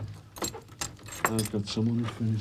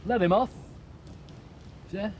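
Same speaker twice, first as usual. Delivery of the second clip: angry shout; 10 semitones higher, much faster than usual. Acted. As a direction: spit it out in bitter rage. Angry drunk confronting neighbour.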